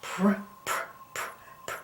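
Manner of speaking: "pr" as unvoiced puffs, no vowel; sounded-out blend. Shown as a demonstration. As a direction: whisper the consonant blend "pr" in separate bursts.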